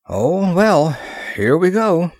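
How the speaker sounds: high, resigned lift; shrugging acceptance. A narrator's reading. Ross giving in to the princess coming, resignedly. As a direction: give in with a vocal shrug. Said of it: "then shrugged".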